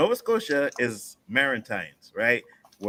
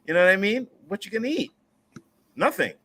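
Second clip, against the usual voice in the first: raised, higher voice of a fed-up parent, a bit louder; mock scolding parent; 7 semitones higher, 4 dB louder, faster than usual. Parent scolding a picky child, mock stern and raised. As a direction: mimic a mother daring the kid to refuse dinner.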